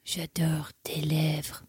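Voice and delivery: slow, hushed French come-on; imitating a creepy suitor's whisper; creepy seductive whisper. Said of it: "says creepy things like".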